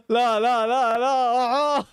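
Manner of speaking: no no through laughter; laughing no. Real, not acted. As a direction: laugh helplessly at the mistake. Laughs at defensive errors.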